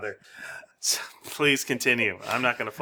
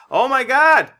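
loud, high cry of Oh my God!; loud high Oh my God! exclamation; wrong shout first; 12 semitones higher, 9 dB louder than usual. Retold or imitated. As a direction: yell Oh my God! high and loud, then retract it. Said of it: "no, he doesn't say, "Oh my God".

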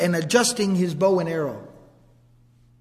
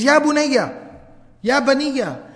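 raised, higher-pitched call for help; 5 semitones higher, 4 dB louder than usual. Retold or imitated. A father calls out to his family from the garden, urgent raised call. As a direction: call out urgently to your children. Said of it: "he calls out to his family".

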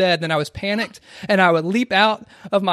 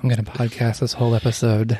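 sultry voice; 5 semitones lower than usual. Pretend. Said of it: "super sultry".

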